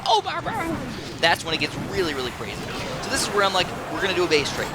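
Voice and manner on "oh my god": high thrilled oh my god; excited outburst. Real, not acted. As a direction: let the excitement jump out. Imagining five or six huts.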